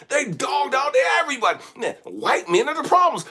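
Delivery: high, loud, impassioned shout; preaching at full force, voice straining high; shouting preacher. Real, not acted. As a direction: cry it out to the congregation.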